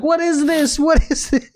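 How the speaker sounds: put-on high, squeaky kid's voice asking in alarm; boy asks his mom about the toilet, squeaky and panicked; scared little boy. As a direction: play a small boy begging his mom for answers. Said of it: "he started asking his mom".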